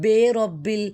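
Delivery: بے رب بل with "bay" in place of "bee"; elders' wrong vowel. Retold or imitated. Teacher imitating elders' mistake, بے رب بل with "bay". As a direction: open the first vowel to ay in بے رب بل. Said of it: "تو یہ غلط تھا".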